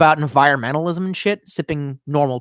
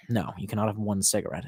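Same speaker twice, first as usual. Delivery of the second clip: quiet scolding; 8 semitones lower, 9 dB quieter than usual. Pretend. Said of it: "Can I have one cigarette?".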